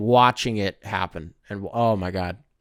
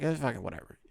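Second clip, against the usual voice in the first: much softer, bored shrug of a voice; mock dismissive; 3 semitones lower, 12 dB quieter, faster than usual. Imitating Puerto Ricans brushing off his Spanish, softer and bored. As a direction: brush it off like you couldn't care less. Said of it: "They'll be like".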